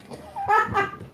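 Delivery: quick high-pitched giggle; light, high burst of laughter. Real, not acted. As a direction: let out a short, high cackle. Reader laughs at the message.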